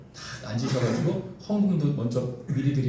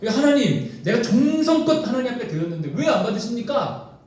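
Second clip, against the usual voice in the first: higher-pitched indignant complaint; acted protest; 7 semitones higher than usual. Israelites asking God why the offering is refused, acted protest. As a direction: protest as the wronged worshippers. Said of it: "이스라엘 백성들이 항의하는 겁니다".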